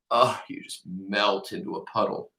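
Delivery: ordinary delivery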